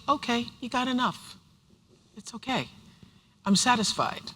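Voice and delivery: quietly telling herself it's okay; gentle self-reassurance. Retold or imitated. She soothes herself that she has enough, softly. Quoting her own self-talk.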